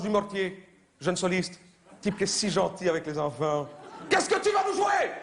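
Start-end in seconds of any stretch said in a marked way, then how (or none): 4.1-5.1 TV host. Acted. Host asks the young soloist, in a high hammed-up voice. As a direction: ham up the sugary kids' show host. Mimics TV host asking child.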